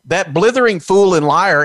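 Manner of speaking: ordinary delivery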